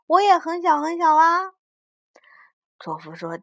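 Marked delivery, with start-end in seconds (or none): 0.1-1.5 put-on high, louder little-kid voice; child character. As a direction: plead like a small child. Child character pleading in story.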